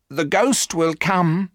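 a hint of a frightened servant prophesying; ominous housekeeper. A narrator's reading. Housekeeper warns the family of the ghost, slow and uneasy.

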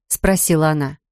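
ordinary delivery

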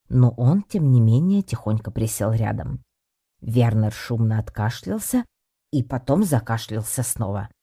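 usual speaking voice

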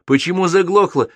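high-pitched berating yell; angry yell. A narrator's reading. Captain yelling at his hapless mechanic, angry and high. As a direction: yell at a useless subordinate. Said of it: "заорал он на незадачливого механика".

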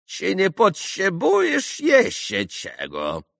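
slow, high, mocking question; ironic. A narrator's reading. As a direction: draw the question out with irony. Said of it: "спросил он иронически". (иронически, с издёвкой)

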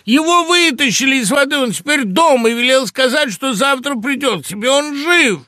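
very loud, high, urgent shouting. A narrator's reading. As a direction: shout it to get through to someone dazed. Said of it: "закричал я громче прежнего". (кричать громко, высоко, настойчиво)

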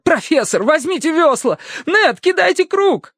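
high, frantic commands; urgent shouted orders. A narrator's reading. Terence orders his friends to the rescue, urgent shouting. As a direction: call out orders in an emergency.

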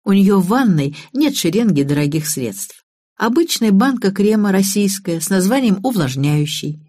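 usual speaking voice

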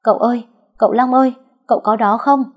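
high, loud, fast call across the yard. Acted. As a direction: shout for him urgently. Servants searching, calling out.

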